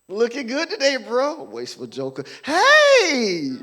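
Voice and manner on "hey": fake shout. Acted fake greeting.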